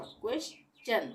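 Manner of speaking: slow क्वेश्चन with a clear चन; stion as chun. Shown as a demonstration. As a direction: stretch क्वेश्चन, ending on चन. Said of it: "यहाँ पे चन का उच्चारण होगा". (धीरे से क्वेश्चन, चन पर ज़ोर)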